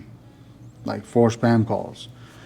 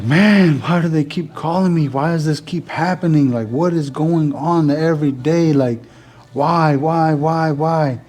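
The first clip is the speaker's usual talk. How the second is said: exasperated; 5 semitones higher than usual. Retold or imitated. Exasperated about repeated spam calls.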